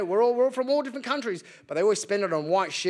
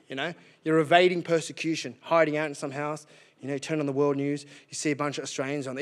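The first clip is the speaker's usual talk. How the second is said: usual speaking voice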